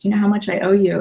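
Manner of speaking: how could you even ask, lightly acted; incredulous exclamation. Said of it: "She goes".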